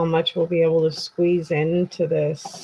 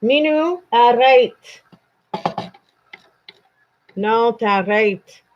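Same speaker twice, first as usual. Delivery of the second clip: loud, raised command to stop; sharp scolding; 4 semitones higher, 7 dB louder than usual. Real, not acted. Speaker orders Minou to stop it, loud scolding. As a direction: tell off a pet loudly in French.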